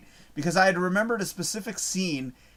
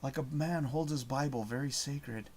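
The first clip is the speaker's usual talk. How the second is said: low, hushed movie-quote voice; near-whisper movie line; 3 semitones lower, 10 dB quieter than usual. Retold or imitated. Quoting a whispered movie line to viewers, hushed and low. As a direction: say it softly, almost under your breath. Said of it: "he's, like, whispering in the scene".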